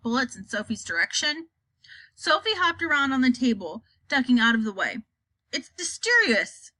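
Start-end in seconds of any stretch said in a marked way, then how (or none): 5.5-6.7 gurgled. Acted. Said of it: "the B F G gurgled".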